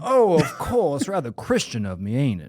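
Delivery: hammed-up Southern planter, self-satisfied; Southern accent. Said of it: "us doing accents at each other".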